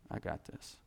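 hushed aside as God's quiet assurance; soft, breathy whisper of I got this; whispered echo. Shown as a demonstration. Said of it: "No, I got this".

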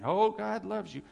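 mock well-meaning reassurance; glib comforter. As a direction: chirp a pat consolation. Mimics glib comfort to the hurting.

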